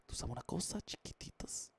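soft ASMR whisper, fading out. Pretend. Streamer whispers a secret to fans, ASMR style. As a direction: breathe it softly into the mic. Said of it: "Voy a hacer una S M R".

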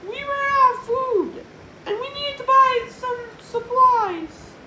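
very high, loud character voice; George explaining. Acted. George explaining to Yesterday George, high and loud.

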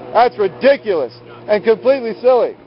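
usual speaking voice

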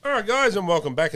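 high, loud announcer greeting; radio host booming back from the break; loud welcome. Real, not acted. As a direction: call the welcome out big. Radio host reopening after break.

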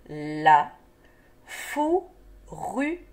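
"La fourrure" split slowly la - fou - rru - re; syllable by syllable. Shown as a demonstration. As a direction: stretch each syllable of "La fourrure" and pause between. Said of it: "des syllabes qui se donnent la main".

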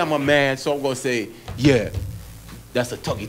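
normal talking voice

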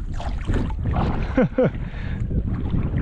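breathy laugh after a sting of pain; real laugh. Real, not acted. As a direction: laugh off the burn. Laughs after getting burned.

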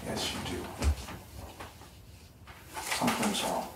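hushed, breathy coaxing whisper; soft unvoiced whisper, no tone. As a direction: whisper it, gently pressing her. Boyfriend presses quietly about what's wrong.

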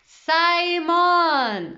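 loud, high, drawn-out name; joyful bark. A narrator's reading. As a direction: proclaim your own name at full voice. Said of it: "il aboya joyeusement". (cri joyeux et claironnant)

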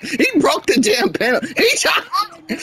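high-pitched, incredulous and breaking into laughter; laughing complaint. Real, not acted. Player reacting to a broken-up pin, laughing disbelief. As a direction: complain high while cracking up.

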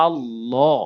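আল্লাহ spoken low with a dark, rounded lla, ending on an o sound; heavy correct Allah. Shown as a demonstration. Teacher modelling the correct recitation for viewers, low and full. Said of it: "শুদ্ধ উচ্চারণ করে বলতে হবে".

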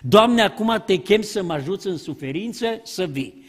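ordinary talk

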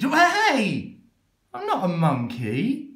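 a reader yelping in outrage; indignant yelp. Acted. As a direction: yelp in protest. Reader objects to the book.

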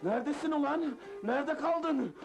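high-pitched, raging tirade; furious scolding. Acted. Boss hitting late Mazlum, furious. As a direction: rant wildly between blows.

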